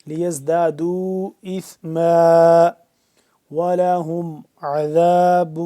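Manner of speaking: formal Quranic recitation. Shown as a demonstration. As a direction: recite with full tajweed, drawn out. Recites verse loudly.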